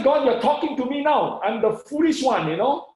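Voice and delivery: high, loud outburst to God; excited. Retold or imitated. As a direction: exclaim it in thrilled recognition. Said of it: "I was so excited".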